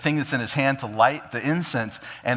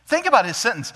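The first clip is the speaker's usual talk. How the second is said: normal talking voice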